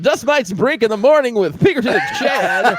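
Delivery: high, laughing radio host intro. Shown as a demonstration. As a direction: open the show like a morning DJ. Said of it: "hey, it's, uh".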